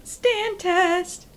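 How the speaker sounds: high, ringing announcer cry; game-show announcement. Acted. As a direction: proclaim the segment like a jingle. Announces a recurring bit.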